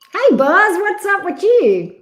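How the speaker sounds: loud, high, bright hello to a friend; shouted cheery welcome to a regular viewer; excited greeting. Real, not acted. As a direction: call out a big happy hey.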